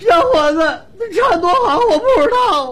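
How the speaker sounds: put-on high, loud wailing old woman; 哭诉的老大娘. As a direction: wail it like a distressed old auntie. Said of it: "大娘说了句话". (老大娘高声哭腔)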